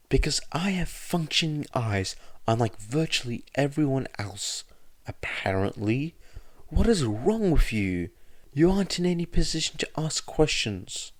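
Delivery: high, biting retort; scornful anger. A narrator's reading. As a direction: cut him down with contempt. Midoriya scornful retort continues.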